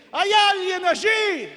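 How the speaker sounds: high, drawn-out shout up to the sky; acted far-carrying yell; desperate cry. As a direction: call for help as the hanging climber. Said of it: "el hombre, en su desesperación, clama al cielo".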